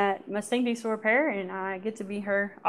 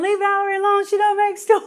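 high, louder mock yell from the bunks; friends screaming; 11 semitones higher, 6 dB louder than usual. Friends yelling at the guard to leave Valerie alone, a mild mock yell. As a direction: call out in her defence, half teasing. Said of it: "screaming in the background".

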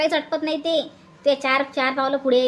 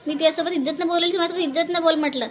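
ordinary talk

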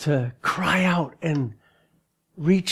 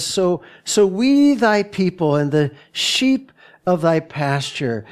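scripture reading; 3 semitones higher, 4 dB louder than usual. Acted. Reads scripture aloud.

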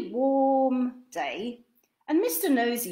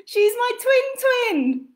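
much higher, loud, slow twin voice; twin explaining; 14 semitones higher, 8 dB louder, slower than usual. Acted. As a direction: spell it out gleefully.

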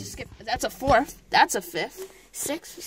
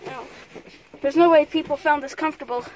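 ordinary talk, nothing marked

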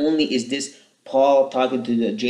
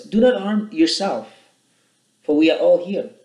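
higher, louder urgent call; loud cry; 7 semitones higher, 5 dB louder than usual. Acted. Paul calling out to the jailer about to kill himself, loud and urgent. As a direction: cry out to stop him. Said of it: "cried out with a loud voice".

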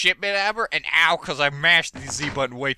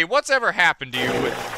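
ordinary talk

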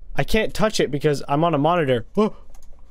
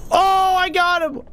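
higher, slow triumphant cry; gleeful high whoop; excited yell; 12 semitones higher, slower than usual. Real, not acted. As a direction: crow over the hit. Reacts to the kill.

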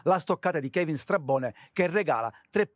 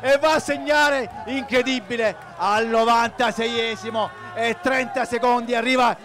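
much higher and louder goal shout; breathless italian goal commentary; 9 semitones higher, 11 dB louder than usual. Acted. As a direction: scream the winning goal. Last-minute winning goal call.

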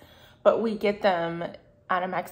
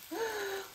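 sharp gasp; 13 semitones higher, 9 dB quieter than usual. Real, not acted. Hears rice frying.

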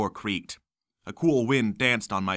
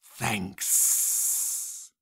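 breathy unvoiced whisper; a creature's soft whispered thanks; 4 dB quieter than usual. A narrator's reading. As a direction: whisper it from the dark. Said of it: "it whispered".

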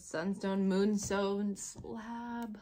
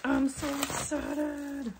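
higher, drawn-out eager gush; excited; 7 semitones higher, 4 dB louder, slower than usual. Real, not acted. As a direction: stretch it out, bursting with eagerness. Repeats, more eager.

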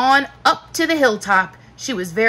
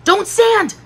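high, loud warning cry; loud urgent command; 11 semitones higher, 5 dB louder than usual. A narrator's reading. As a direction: stop her sharply, pitched up. Urgent warning near the dinosaur.

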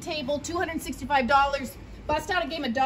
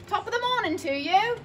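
light mock Irish accent, pitched higher; Irish greeting; 9 semitones higher, 4 dB louder than usual. Shop host greets buyers in a playful Irish accent. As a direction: chirp it like a stage Irishman. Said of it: "you have to speak like that".

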